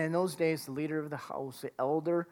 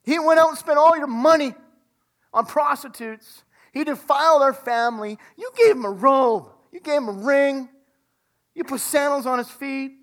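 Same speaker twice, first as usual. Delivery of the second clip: a touch of indignant complaint, louder; resentful older son; 3 semitones higher, 5 dB louder than usual. Retold or imitated. Older son complaining to his father, mildly indignant. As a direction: protest the unfairness. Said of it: "And the son says".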